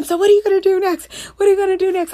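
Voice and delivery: high, loud, rapid-fire eager questioning; imitating your own overkeen, excited pestering; all fired up. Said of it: "I was, like, all fired up".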